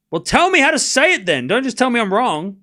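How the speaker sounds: high, loud exasperated retort. Real, not acted. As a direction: push back at the correction, pitched high and forceful. Exasperated retort to pronunciation correction.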